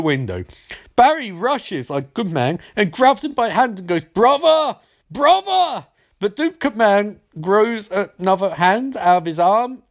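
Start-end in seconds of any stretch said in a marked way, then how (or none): 4.0-5.9 hammed-up plea to a falling foe; overacted tragic shout; mock anguished cry. Hero pleading as villain falls.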